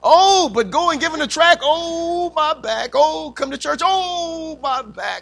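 hammed-up whining over a sore back; mock aching moans. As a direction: moan theatrically between chores. Mock moaning about back at each duty.

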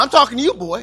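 loud, high rebuke; scolding mother. Retold or imitated. Mother to her cheeky son, loud scolding. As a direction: pull the boy up sharply, loud. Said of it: "And she's like".

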